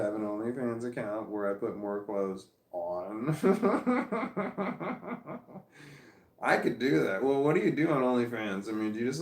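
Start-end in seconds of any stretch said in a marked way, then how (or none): 3.4-6.0 big high burst of laughter; loud real laugh. Real, not acted. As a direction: really crack up. Laughs at own OnlyFans joke.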